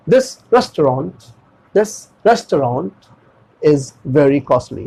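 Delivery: very slow example, restaurant as "res-to-raant"; stretched pronunciation. Shown as a demonstration. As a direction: draw out the end of restaurant, slowly. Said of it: "ताकि वो stretch हो सके". (बहुत धीरे, restaurant को खींचकर)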